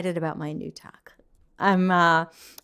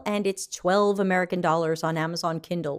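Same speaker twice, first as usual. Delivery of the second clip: ordinary talk, nothing marked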